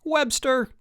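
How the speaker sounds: high-pitched summoning call. A narrator's reading. Chip appealing to the referee, calling out. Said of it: "called Chip".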